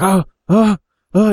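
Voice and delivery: high, loud "oh" gasps; acted panicky panting cries; panicked gasps. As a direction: gasp as if fighting to stay conscious. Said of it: "he's panicking".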